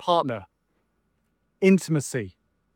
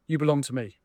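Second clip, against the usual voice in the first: softer and lower, gently reassuring; tender; 5 semitones lower, 5 dB quieter, faster than usual. Retold or imitated. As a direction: lay it down softly like a promise. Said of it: "Jesus says".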